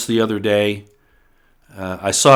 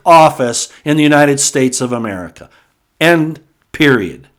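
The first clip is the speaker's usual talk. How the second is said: emphatic; 5 semitones higher, 4 dB louder than usual. Real, not acted. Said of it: "I can get pretty emphatic sometimes".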